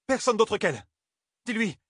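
very high, insistent instruction; urgent called-out order. A narrator's reading. Nineveh insists on whom Elaine fetches, high and urgent. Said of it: "lança-t-elle à Elaine".

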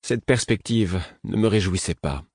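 ordinary delivery, nothing marked

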